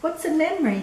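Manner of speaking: put-on childlike question. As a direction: ask it like a curious little boy.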